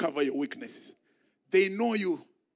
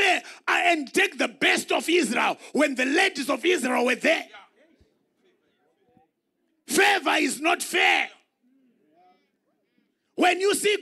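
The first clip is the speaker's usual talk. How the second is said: louder and higher, shouted sermon climax; preacher shouting to stir the church; full-force preaching; 9 semitones higher, 6 dB louder than usual. Acted. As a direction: preach it out loud to the congregation.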